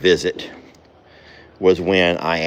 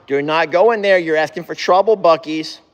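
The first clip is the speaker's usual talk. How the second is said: high, loud call to the animals; 8 semitones higher, 3 dB louder than usual. Real, not acted. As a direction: raise the voice and shoo them out. Calling horses out of barn.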